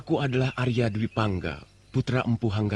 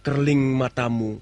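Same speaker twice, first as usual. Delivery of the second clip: poem recitation; 3 semitones higher, 4 dB louder than usual. Acted. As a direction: recite the verse with feeling, building up. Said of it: "Aku akan bacakan satu bait syair". (membaca syair dengan penuh perasaan)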